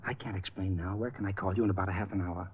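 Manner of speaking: nervous hushed aside at the door. Acted. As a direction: keep it down so the wife can't hear. Husband hiding talk from wife.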